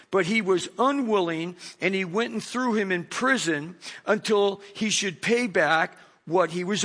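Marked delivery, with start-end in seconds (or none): none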